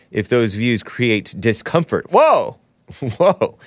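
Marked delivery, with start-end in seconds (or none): 2.1-3.6 mock surprise. Mock shock at quote.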